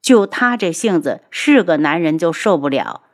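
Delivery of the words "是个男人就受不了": usual speaking voice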